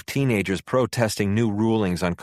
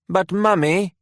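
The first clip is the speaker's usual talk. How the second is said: put-on much higher child voice, British, pleading; a little British boy protesting to his mum; young son begging his mother, high child's voice; small boy pleading; 10 semitones higher, 4 dB louder than usual. A narrator's reading.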